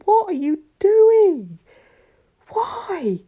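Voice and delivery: high, loud, drawn-out wail of disbelief; exasperated shouting. Acted. Viewer shouting at her own video on screen, exasperated. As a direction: yell helplessly at your own recording. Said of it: "almost shouting at the scene, going".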